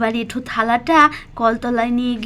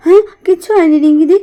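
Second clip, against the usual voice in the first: flustered denial; 4 semitones higher, 7 dB louder, much faster than usual. Acted. Caught listening, hurried denial.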